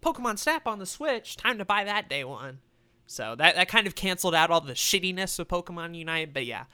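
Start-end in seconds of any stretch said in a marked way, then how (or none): 0.0-2.6 excited. Retold or imitated. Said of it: "and I'm like".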